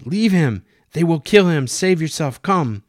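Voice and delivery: high, loud and urgent; urgent shout. Acted. Katerina dragging Celia from Juan, shouting urgently. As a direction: pull her away with a shouted command.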